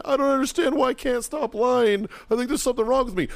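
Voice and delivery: high-pitched whiny sobbing imitation; mock crying. Scolded kid confessing to his sergeant, mock crying. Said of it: "He starts crying".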